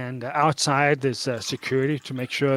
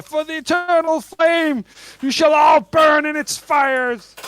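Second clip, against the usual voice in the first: high, loud fanatical shout, drawn out; shouting cultist; 12 semitones higher, 6 dB louder than usual. Acted. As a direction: shout it like a ranting doomsday zealot. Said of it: "who, uh, shouts out".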